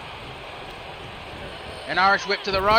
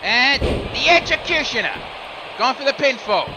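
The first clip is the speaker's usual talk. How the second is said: excited pin count; 4 semitones higher than usual. Acted. Big move and pin count.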